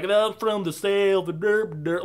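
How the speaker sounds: hammed-up Southern twang, slurring into gibberish; mock Southern accent. Dude version of what she does in this movie, mock Southern. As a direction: parody a bad Southern accent. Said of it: "I'm doing the dude version of what she does".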